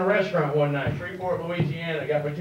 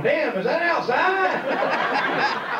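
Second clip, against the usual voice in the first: high, drawn-out mock disbelief; appalled exclamation; 8 semitones higher, much slower than usual. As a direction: imitate drivers crying out, stretching the last word. Mimicking appalled passing drivers.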